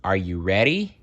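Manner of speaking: plain reading voice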